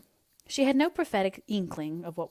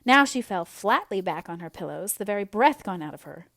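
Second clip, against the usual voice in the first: usual speaking voice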